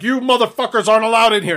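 retold indignant outburst; mock angry. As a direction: play-act a biker's angry warning. Bikers kicking out Mexicans, retold.

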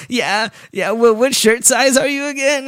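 high-pitched nervous quiver; quivering fanboy. Retold or imitated. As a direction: imitate a starstruck fan barely holding it together. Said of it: "the way you do that quivering voice".

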